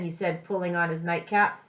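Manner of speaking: ordinary delivery, nothing marked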